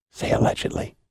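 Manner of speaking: hushed, voiceless aside; whisper. Retold or imitated. Wrestler secretly feeding the announcer a line, whispered. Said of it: "and he whis-, whispers to me".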